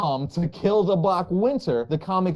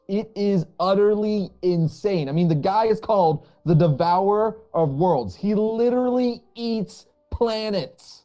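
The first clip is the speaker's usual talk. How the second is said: slow, higher, stunned amazement; amazed disbelief; 4 semitones higher, 3 dB louder than usual. Real, not acted. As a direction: marvel at it, dragging the words. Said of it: "I'm just trying to wrap my head around this".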